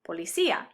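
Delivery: po-li-CÍ-a, í and a kept apart; diphthong broken up. Shown as a demonstration. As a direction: give "policía" a stressed í before the final a. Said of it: "use an accent to break up a diphthong".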